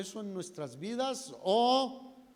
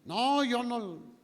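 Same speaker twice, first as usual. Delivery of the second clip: higher-pitched, drawn-out protest; acted slow, raised-voice denial; emphatic denial; 7 semitones higher, 4 dB louder, slower than usual. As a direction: deny it insistently, stretching the words. Peter's acted denial to accuser.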